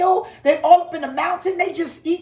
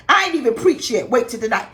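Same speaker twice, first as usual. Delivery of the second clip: ordinary delivery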